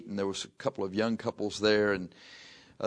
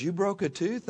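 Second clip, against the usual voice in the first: put-on surprised young woman; 10 semitones higher than usual. Young lady surprised, voiced.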